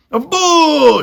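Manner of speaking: very high, loud boo; jeering shout. A narrator's reading. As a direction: boo him at full voice. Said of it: "shouted Botticelli again".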